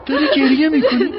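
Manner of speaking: alarmed concern. Acted. Finds Arina crying.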